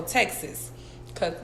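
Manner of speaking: usual speaking voice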